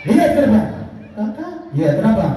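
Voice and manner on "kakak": high, soft little puppet voice; monkey puppet voice. Acted. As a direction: squeak it like a shy monkey puppet. Puppet monkey talks to host.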